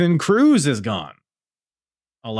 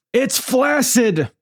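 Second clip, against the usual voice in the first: high, drawn-out commentator's cry; Callum Leslie; 13 semitones higher, 4 dB louder, slower than usual. Retold or imitated. Podcaster imitating the match commentator's great call, high commentator cry. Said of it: "Callum says".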